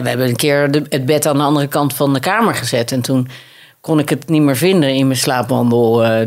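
ordinary talk, nothing marked

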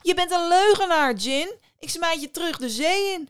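high, loud triumphant declaration; defiant fisherman. A narrator's reading. Fisherman rejects djinn. (luide, hoge vissersstem)

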